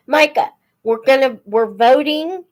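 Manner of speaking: hurried call to someone nearby. Real, not acted. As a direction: call over to Micah quickly. Calling out to Micah mid-stream.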